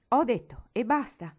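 loud, high, peremptory; angry final word. A narrator's reading. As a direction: shut the argument down forcefully. Uncle cuts off the protest. (perentorio e adirato)

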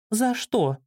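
put-on high, puzzled child voice; wondering child, pitched high; surprised little girl. A narrator's reading. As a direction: ask in surprise like a small girl. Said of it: "удивилась девочка".

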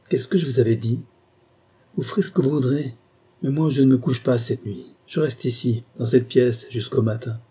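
ordinary delivery, nothing marked

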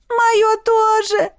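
high, slow wail; heartbroken. A narrator's reading. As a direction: wail it out, stretching the words. Heartbroken maid answers her lover.

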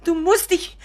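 high, loud anxious begging; overwrought pleading. Acted. Mother implores Richard to share his worries, high anxious pleading. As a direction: plead as if losing him.

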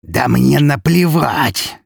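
slow, high, loud outburst of fury; drawn-out furious snarl; enraged growl. A narrator's reading. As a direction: roar it into the face. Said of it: "прорычал взбешённый граф".

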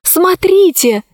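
high, slow cry; exclaiming. Acted. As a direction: point it out, amazed. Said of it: "воскликнула она".

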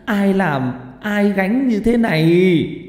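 high, loud, slow lament; wailing. Acted. Bà Tình wails over her unconscious son. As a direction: wail over your son's fate. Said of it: "Bà Tình gào lên".

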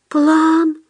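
high, timid, hopeful voice; timid question. A narrator's reading. Худяков hardly believes his luck, timid high question. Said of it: "робко спросил Худяков, не веря своему счастью".

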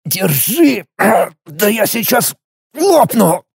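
high, strained, groaning outburst; straining groan. Acted. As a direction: groan it out under heavy strain. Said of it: "простонал Алекс".

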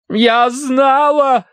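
high, slow, loud cry; triumphant exclamation. A narrator's reading. Wife cries out from the sofa, triumphant. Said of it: "воскликнула миссис Меринг".

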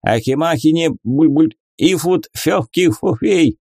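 words gurgled through a mouthful of potion; gurgling while drinking. Acted. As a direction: talk into liquid, bubbling. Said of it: "пробулькал Парацельс".

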